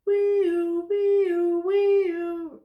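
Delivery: high wee-oo siren noise; a friend's siren sound, imitated; siren imitation. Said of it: "And he said".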